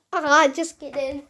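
normal talking voice

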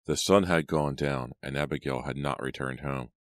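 usual speaking voice

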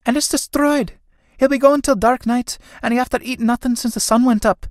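high, exclaiming with distress; dismayed younger sister. Acted. Nora frets that Bartley has no food, high dismay. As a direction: fret aloud for your brother, pitched high.

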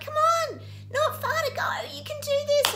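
high, loud cheering shout. Retold or imitated. Cheering strangers running out of the tunnel, loud and high. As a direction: urge them over the finish line. Cheering runners towards their goals.

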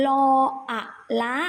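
ละ sounded out as ลอ, อะ, ละ; pronunciation demo. Shown as a demonstration. Teacher shows how ละ is read, careful spelling aloud. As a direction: give ละ a short a after spelling its parts. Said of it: "คำนี้ออกเสียงว่า".

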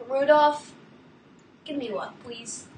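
ordinary talk

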